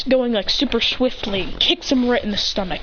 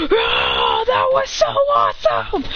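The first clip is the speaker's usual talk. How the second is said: slow, high crying shout of delight; overjoyed after the fight; excited shout; slower than usual. Acted. Fighter thrilled by Goku, high excited shout. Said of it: "he goes".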